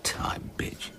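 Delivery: unvoiced whisper under the breath; whispered aside. Crew member whispering a snide aside about the cash. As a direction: hiss it so nobody hears.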